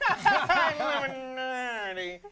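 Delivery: laughing, very drawn-out copy of the guest; stretched-out teasing imitation; mocking echo. Echoes the guest's answer, laughing.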